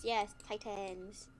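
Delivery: usual speaking voice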